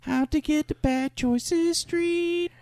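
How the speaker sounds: high, slow Elmo voice; Elmo impression. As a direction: drawl it like a puppet. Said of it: "bad Elmo impression".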